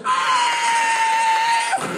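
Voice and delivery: shrieking car-chase scream; hammed-up high yell. Comic mid Starsky and Hutch turn, high scream. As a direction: scream through a wild U-turn.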